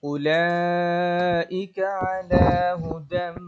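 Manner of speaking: slow, drawn-out melodic recitation; careful tajweed chant, vowels held long; Quran recitation. Shown as a demonstration. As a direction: chant each word long and full. Teacher recites again as model.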